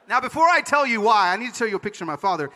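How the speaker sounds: normal talking voice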